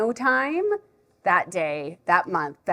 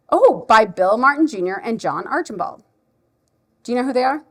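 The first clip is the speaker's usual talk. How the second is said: louder, animated read-aloud opening into a question; lively storytime quizzing; reading to a child; 5 dB louder than usual. Shown as a demonstration. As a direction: make a discovery for a little listener. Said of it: "read it like I would if a child is here".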